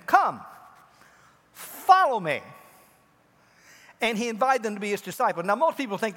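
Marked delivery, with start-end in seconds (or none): 0.1-2.4 louder, higher call to follow; Jesus calling out. Retold or imitated. Jesus calling the fishermen, raised call. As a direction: call them to come with you. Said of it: "he said to them".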